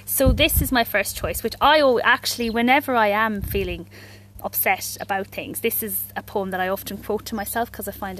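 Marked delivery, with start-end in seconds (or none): none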